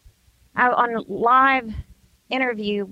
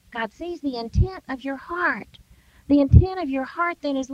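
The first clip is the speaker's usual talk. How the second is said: usual speaking voice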